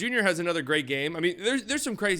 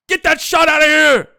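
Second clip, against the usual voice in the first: put-on loud, much higher shout in a failed German accent; 12 semitones higher, 14 dB louder than usual. Vlogger calling a blocked shot, loud mock German accent. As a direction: bark the block call in a hammed-up German accent. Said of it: "That was supposed to be a German accent".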